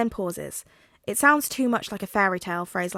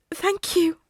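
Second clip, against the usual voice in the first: higher, choked with tears; choked-up thanks; 9 semitones higher, 4 dB louder than usual. A narrator's reading. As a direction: push the thanks through tears. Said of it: "Tensei chokes out".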